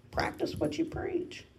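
a lighter playful repeat. Shown as a demonstration. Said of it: "Practice what you preach, Carrie".

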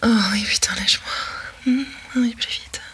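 rising ecstasy. Acted. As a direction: let it climb until you cry out. Roleplay building to climax.

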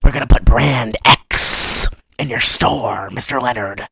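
low, scary villain voice; hammed-up sinister threat; Christopher Lloyd. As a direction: drop low and menacing, like a creepy villain. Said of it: "has this scary-ass voice".

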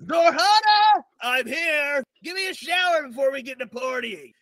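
tipsy gnome pirate agreeing happily; laughing gnome pirate. Acted. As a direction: cackle it off and stumble away. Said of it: "And he said".

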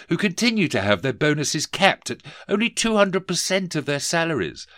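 a lightly voiced toff asking politely; pompous gentleman. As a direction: collector addressing the office owner. Rees-Mogg implores.

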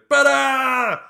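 sudden long, high yell out of a window; very loud, high-pitched mock holler; imitated shout. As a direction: bellow it at full force like a stranger shouting. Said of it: "where a guy just shouts".

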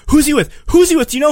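mimicked high, loud interrogation; angry yelling. Jenna catches Chris on the phone, angry yelling. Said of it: "Jenna gets angry and starts yelling".

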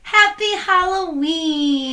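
slow, high, loud Halloween cheer; excited shout. Acted. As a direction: draw out a spooky holiday cheer. Spooky holiday greeting.

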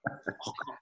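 breathy whispered oh God, pain remembered; whispered groan. Retold or imitated. Retelling the broken nose to his mate, a whispered wince. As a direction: whisper it as if wincing at the memory.

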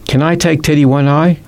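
lightly voiced little boy; little boy asking. A narrator's reading. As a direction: ask like a small child. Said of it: "asked B B".

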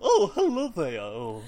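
loud, high posh housekeeper; posh lady voice. Retold or imitated. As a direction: put on a prim upper-class voice. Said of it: "talks like Professor Wakeman".

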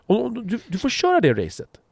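hammed-up, syrupy mock-supportive voice; mock sweet encouragement. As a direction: cheer him on like a child. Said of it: "ni är försiktiga".

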